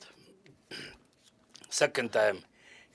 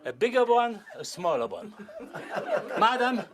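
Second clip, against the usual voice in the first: amused and chuckling; 7 semitones higher than usual. Real, not acted. Magician chuckling over potatoes.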